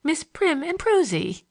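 high, drawn-out mock title; lightly mirthful, savour the nickname slowly; schoolgirl's amused, high-pitched delivery. A narrator's reading. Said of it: "seemed moved to mirth by the recollection".

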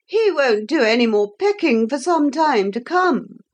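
high-pitched, self-satisfied character voice; high voice full of satisfaction. A narrator's reading. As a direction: pitch it high, pleased with the outcome. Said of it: "said the Pretenderette with satisfaction".

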